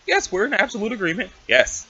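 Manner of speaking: ordinary talk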